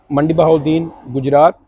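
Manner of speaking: usual speaking voice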